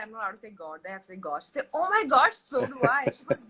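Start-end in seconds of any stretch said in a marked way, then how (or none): 1.7-3.1 high, loud gushing agreement; playful mock excitement. Aunt playing along with little niece, loudly excited. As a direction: squeal along with a child, hammed up.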